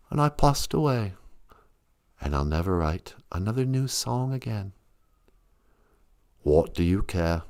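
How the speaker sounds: low ghostly rock star from beyond. Retold or imitated. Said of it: "doing a [name] voice".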